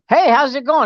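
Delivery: high, chirpy dad greeting; cheerful morning greeting. Retold or imitated. Dad greeting his sleepy daughter in the morning, cheerful. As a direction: greet her with cheerful, lifted pitch. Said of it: "Your cheerfulness is eating me alive".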